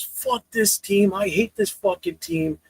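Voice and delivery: put-on high, slow whiny kid voice; mocking the kid fan. Mocking a Patriots kid quitting his team in a high, slow whine. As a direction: whine it like a sulking young fan. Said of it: "And he goes".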